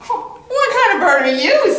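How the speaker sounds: high quacky duck voice; duck's retort. Acted. Duck answering the bird, high and indignant. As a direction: squawk it back high.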